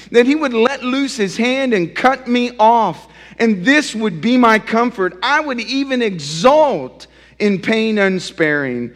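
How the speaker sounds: loud, high, desperate plea; pleading. Acted. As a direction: beg God to end it all. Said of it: "look at how he's pleading".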